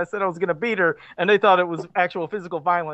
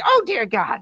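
horrified grandma; 4 semitones higher, 4 dB louder than usual. Retold or imitated. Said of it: "And they say".

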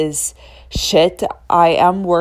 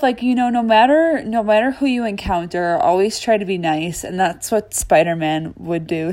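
normal talking voice

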